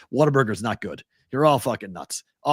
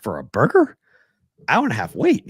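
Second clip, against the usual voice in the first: incredulous; 4 dB louder than usual. Retold or imitated. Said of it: "I was like".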